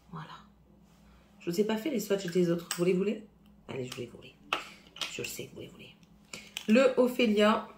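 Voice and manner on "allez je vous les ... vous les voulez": hushed teasing. Real, not acted. Teasing the viewers in a hush.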